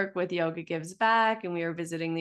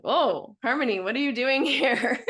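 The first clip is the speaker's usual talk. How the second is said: higher, louder, delighted exclamation of surprise; surprised greeting; 6 semitones higher, 5 dB louder than usual. Retold or imitated. Teacher spots visiting student walking in, surprised greeting. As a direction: greet someone you never expected, laughing through it.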